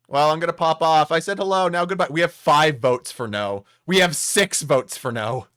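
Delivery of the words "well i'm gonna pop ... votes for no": ordinary delivery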